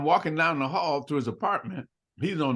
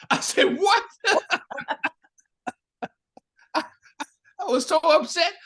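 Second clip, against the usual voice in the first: laughing; 4 dB louder than usual. Real, not acted. Laughs retelling the insult.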